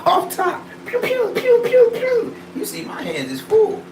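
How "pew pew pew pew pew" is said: squeaky high laser pew sounds; high pew gun noises. Pretend. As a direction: pew pew like a toy blaster.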